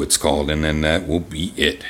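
normal talking voice

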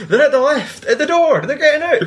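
high, loud, urgent put-on shout; mock alarmed alert. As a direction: call it out in hammed-up alarm.

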